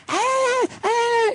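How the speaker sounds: very high mock chatter noise; women's voices going up. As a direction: squeal like women over bar din. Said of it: "their voices go up".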